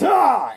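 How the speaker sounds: high loud battle cry; a sudden high loud yell; villain yells as lightning fires; attack yell. A narrator's reading. Electro attacks Spider-Man.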